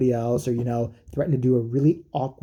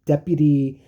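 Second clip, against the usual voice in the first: usual speaking voice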